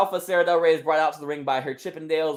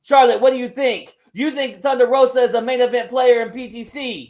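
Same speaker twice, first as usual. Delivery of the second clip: loud, higher promo call; rallying the crowd; 5 semitones higher, 6 dB louder than usual. Acted. Wrestler asks Charlotte what they think, rallying loudly. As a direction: work the arena for an answer.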